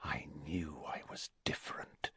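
very soft, low whisper. A narrator's reading. Boy whispering to his own quivering fingers, whispered. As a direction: whisper it privately to yourself. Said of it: "he whispered to his own quivering fingers".

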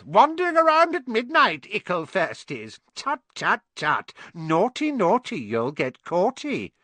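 high, gleeful poltergeist sing-song; mischievous imp mocking naughty children; cackling taunt. A narrator's reading. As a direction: tease them in a shrieky cackling voice. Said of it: "Peeves cackled".

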